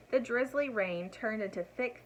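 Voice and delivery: ordinary delivery, nothing marked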